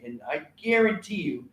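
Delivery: normal talking voice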